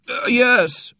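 hesitate, then squeeze it out high; nervous schoolboy. A narrator's reading. Boy faking pain to the doctor, nervous.

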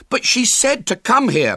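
put-on high, loud aggrieved boy; indignant high-pitched objection; protesting. A narrator's reading. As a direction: protest at being blamed. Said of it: "protested William".

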